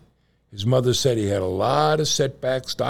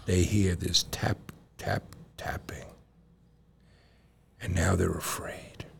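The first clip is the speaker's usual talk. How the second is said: spooky whisper; 4 semitones lower, 7 dB quieter than usual. A narrator's reading. Spooky cemetery story.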